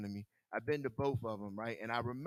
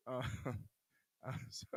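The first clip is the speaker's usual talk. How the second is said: fast, broken up by laughter; laughing; 5 dB quieter, much faster than usual. Real, not acted. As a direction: try to talk but the laugh wins. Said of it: "It's just funny".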